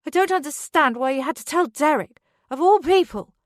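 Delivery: high, loud angry shout. Acted. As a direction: shout it at him. Drunken quarrel with Charlie.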